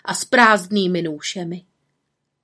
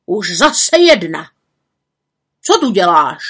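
gruff forester; 8 dB louder than usual. Acted. Forester scolding the woman with firewood, loud. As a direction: bark at the trespasser.

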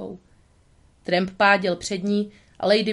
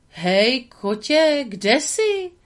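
calling out; 5 semitones higher, 4 dB louder, much slower than usual. A narrator's reading. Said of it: "zakřičel".

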